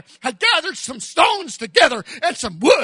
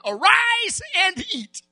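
high, ringing call to rise; a high angelic command to get up; angel's command; 11 semitones higher than usual. Acted. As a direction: sing out the angel's wake-up call. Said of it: "an angel touched him and said unto him".

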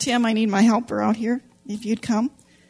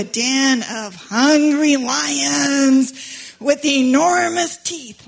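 slow, menacing stretch of the words; hungry lions, told slow and big; dramatic slow build; 3 semitones higher, 4 dB louder, much slower than usual. Acted. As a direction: draw it out to spook the kids. Dramatic storytelling to kids.

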